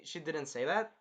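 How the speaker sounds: ordinary talk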